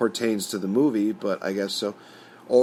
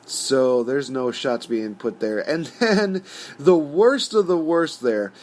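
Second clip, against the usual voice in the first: normal talking voice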